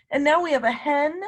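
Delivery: ordinary talk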